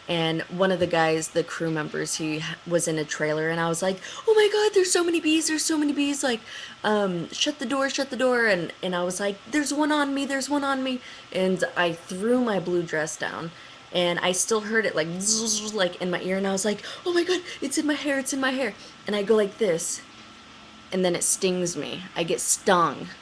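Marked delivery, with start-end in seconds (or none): 4.3-8.4 high, fast panicked cry. Retold or imitated. To the crew guy, panicking about bees, high and fast. 9.5-11.0 high frantic squeal; panicked. Retold or imitated. To the crew, feeling a bee on her, high and frantic. 17.0-18.7 high, fast frantic squeal; panicked. Retold or imitated. Hearing the bee in her hair, high fast panic.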